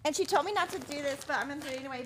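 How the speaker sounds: ordinary talk, nothing marked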